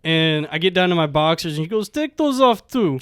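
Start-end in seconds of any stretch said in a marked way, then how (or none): 1.9-3.0 higher mock Russian order; put-on Russian woman; thick Russian accent. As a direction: imitate her Russian accent, pitched up. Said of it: "she has, like, a thick Russian accent".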